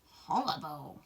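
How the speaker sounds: mild grossed-out rabbit voice; a touch of disgust. Acted. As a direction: pull a face as you say it. Rabbit tastes the scone.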